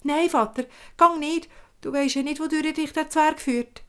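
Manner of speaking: high, anxious begging; pleading child. Acted. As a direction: stop him, scared for dad. Son begs father not go.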